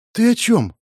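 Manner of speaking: a touch of alarm in the question; wary. A narrator's reading. Woman hears the pyromancer's idea, wary. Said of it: "насторожилась Марина".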